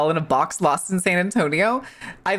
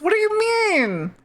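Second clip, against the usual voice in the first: high, squealed mock protest; squeaky feigned outrage; mock disbelief. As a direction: shoot way up in playful indignation. Mock outrage at a chat message.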